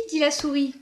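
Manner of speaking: ordinary delivery, nothing marked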